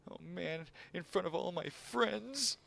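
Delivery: high, slow mocking squeak; squeaky high voice. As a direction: squeak it out in mock shame.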